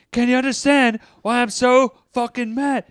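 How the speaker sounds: high-pitched, exasperated movie quote; imitated fed-up outburst; mock angry rant. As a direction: act out a furious flatmate, pitched right up. Acting out movie's angry flatmate rant.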